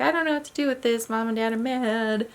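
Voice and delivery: put-on a child unsure what to do; anxious child voice. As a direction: rush it like a confused child. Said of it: "they're like".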